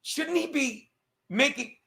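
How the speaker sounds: normal talking voice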